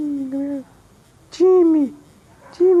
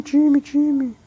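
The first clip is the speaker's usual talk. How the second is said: ordinary talk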